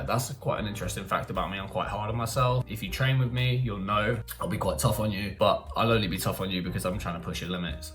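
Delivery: ordinary talk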